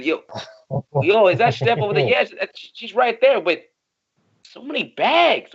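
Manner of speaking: high-pitched astonished call; amazed. Acted. Friend spotting Steph with all the bags, amazed. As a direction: marvel in a high voice at what you spot.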